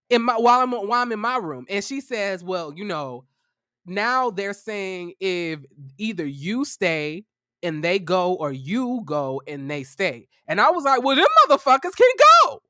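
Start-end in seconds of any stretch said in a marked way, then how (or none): 2.4-10.3 lower, softer, slow landlady delivering the ultimatum; lightly voiced landlady, measured and slow. Landlady relays the roommates' ultimatum. 11.0-12.6 high, loud and fast, fed up; defiant shout. Retold or imitated. Furious retort to landlady's ultimatum.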